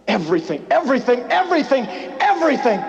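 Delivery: rising chant of everything; building repetition. Acted.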